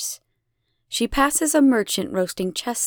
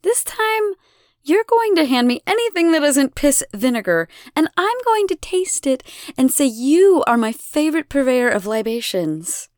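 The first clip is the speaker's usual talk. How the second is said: higher, louder, sing-song threat; mock-sweet goddess; 6 semitones higher, 4 dB louder than usual. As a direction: sweetly dictate his lines. Elena lecturing the brewer.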